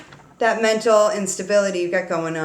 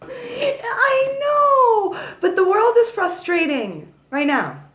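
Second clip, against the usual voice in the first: high laughing cry of defence; laughing protest; 14 semitones higher, slower than usual. Real, not acted. Reader laughs back at dad's scolding, high laughing protest.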